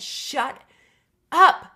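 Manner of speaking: high, loud cry of shut up, rising in pitch; retold outburst. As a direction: yell it at the voice in your head to make it stop. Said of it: "And I just said".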